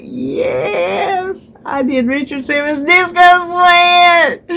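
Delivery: high, slow, gushing excitement; light up about the favourite workout; excited. Real, not acted. Excited about Richard Simmons workout.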